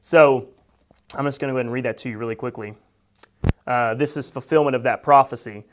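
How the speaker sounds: ordinary delivery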